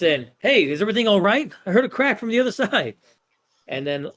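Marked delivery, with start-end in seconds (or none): 0.4-3.0 higher-pitched, quick questioning; high, hurried student voice; concerned student bursting in. Acted. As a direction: burst in and check on everyone.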